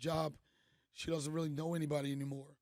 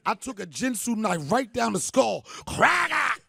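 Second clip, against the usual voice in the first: loud, rising into a high yelled cracker; hammed-up shout; 4 semitones higher, 10 dB louder, slower than usual. To the crowd, a hammed-up shouted boast. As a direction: shout it like a battle cry. Comic crab killing boast.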